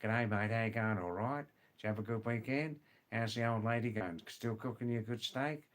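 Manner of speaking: broad, run-together Aussie English; extreme Australian accent. Shown as a demonstration. Teacher showing online learners extreme Australian English, broad Aussie accent. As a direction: slur it together in thick Australian. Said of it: "not extreme Australian English, like".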